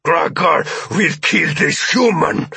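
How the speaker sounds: high, slow alien shout; alien's threat. Acted. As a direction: threaten as a desperate alien captor. Alien threatens hostage while shouting.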